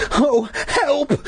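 high-pitched tearful plea; hammed-up distressed cry. A narrator's reading. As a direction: wail for help theatrically. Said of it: "I cried".

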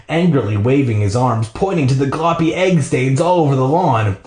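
usual speaking voice

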